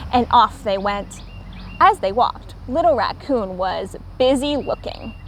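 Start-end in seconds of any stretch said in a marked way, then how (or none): none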